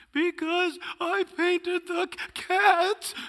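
put-on high, slow child voice; little kid confessing. Junior confessing to dad, high childish voice. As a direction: squeak it out like a guilty little boy.

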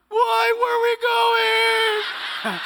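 high, loud, drawn-out crying wail; panicked child wail. Retold or imitated. Junior dreading the car ride, crying wail. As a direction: wail it like a terrified little kid. Child wails fearing punishment.

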